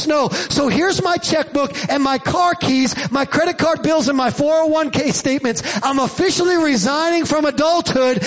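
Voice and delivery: impassioned reading, climbing steadily in pitch; building recitation. Acted. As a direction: start plainly, build to a high-pitched declaration. Recites an adult's resignation piece.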